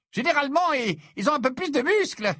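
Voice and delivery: voix haute, dédaigneuse; prends-le de haut; ton hautain. A narrator's reading. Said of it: "dit Zacharias d'un ton hautain".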